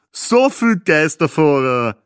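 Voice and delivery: loud, slow, drawn-out quip; joking husband. A narrator's reading. Husband teases his wife about the seat mix-up, joking. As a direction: crack it as a booming, stretched joke. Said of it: "scherzte ihr Mann".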